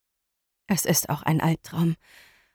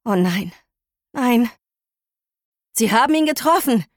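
horrified; 3 semitones higher than usual. Acted. Narrator sees whale hit.

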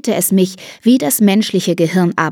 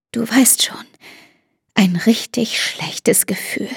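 whisper; 3 semitones higher, 3 dB quieter than usual. Acted. Mother keeps whispering.

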